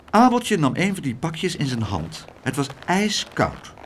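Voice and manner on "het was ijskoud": usual speaking voice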